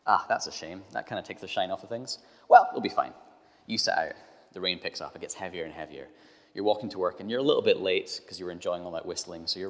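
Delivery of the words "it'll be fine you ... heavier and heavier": usual speaking voice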